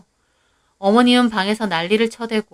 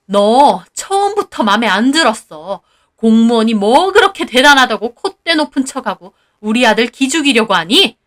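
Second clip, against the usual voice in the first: mother-in-law's attack; 6 dB louder than usual. Acted. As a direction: sneer and scold. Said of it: "다시 따지시더군요". (시어머니의 경멸 섞인 호통)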